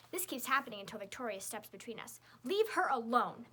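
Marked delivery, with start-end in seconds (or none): 2.4-3.5 loud, slow, commanding; loud booming order. A narrator's reading. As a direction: boom it right up his nose. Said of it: "Victoria booms at Hopper".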